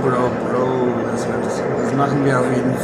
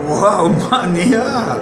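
excited wow; 7 semitones higher, 5 dB louder, much slower than usual. Real, not acted. Hears idea of hippo hunt.

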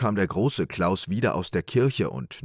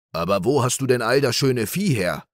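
higher and louder, amazed; astonished question; 4 semitones higher, 5 dB louder than usual. Acted. Big Klaus amazed at little Klaus's cattle. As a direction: blurt it out in disbelief. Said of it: "fragte der große Klaus".

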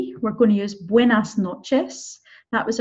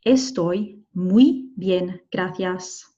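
"Estoy muy bien, gracias" very slow, separated; slowest, careful "Estoy muy bien, gracias"; word-by-word model; 2 semitones lower, much slower than usual. Shown as a demonstration. Teacher repeats word by word.

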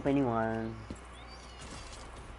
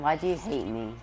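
ordinary delivery

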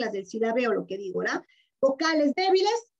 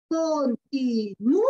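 slow, spelled out; much slower than usual. Shown as a demonstration. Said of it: "Miren así".

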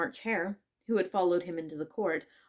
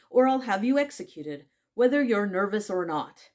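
angry; 3 semitones higher, 8 dB louder than usual. A narrator's reading. King threatens the Hatter with execution, angrily. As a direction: threaten with raised voice and temper. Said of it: "the king repeated angrily".

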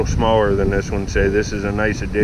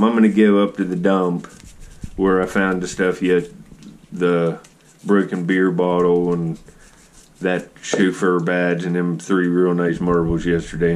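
ordinary talk, nothing marked

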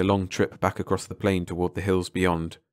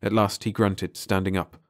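usual speaking voice

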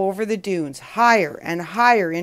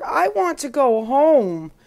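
put-on higher, plaintive child's plea; plaintive cry; 5 semitones higher than usual. A narrator's reading. Lost boy longs for home, plaintive cry. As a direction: plead like a homesick little boy. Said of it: "cries Oscar".

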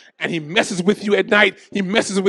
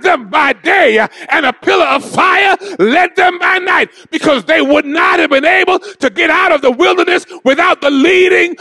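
shouted, higher sermon climax; full-force preaching; 8 semitones higher, 5 dB louder than usual. Acted. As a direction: preach it loud to the congregation.

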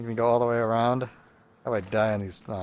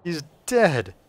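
gleeful shout; 4 semitones higher, 7 dB louder than usual. Real, not acted. Angry elf teen killed in game.

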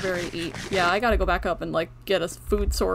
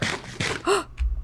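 sharp unvoiced gasp of surprise; a quick excited intake of breath; 9 semitones higher than usual. Real, not acted. Spots diamonds, gasps.

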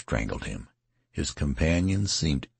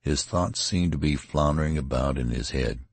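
usual speaking voice

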